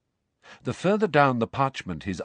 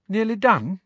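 Hermione; 10 semitones higher, 4 dB louder than usual. A narrator's reading. Hermione voiced in the story.

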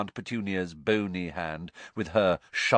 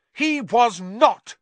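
put-on high, slow, louder defiant boy; Harry snapping; 14 semitones higher, 8 dB louder, much slower than usual. A narrator's reading. Harry defending his father, high and angry. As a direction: blurt it out in anger. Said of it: "said Harry suddenly".

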